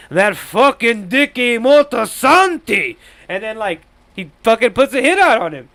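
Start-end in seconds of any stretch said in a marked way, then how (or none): 0.1-3.0 slow, higher, louder mock grudge; grudging mutter. Junior cursing Dicky after breaking his hip, mock grudge. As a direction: spit the name like a sore loser. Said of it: "And he's like".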